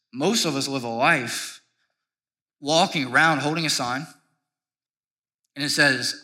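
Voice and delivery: ordinary delivery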